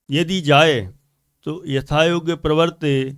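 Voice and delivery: ordinary talk, nothing marked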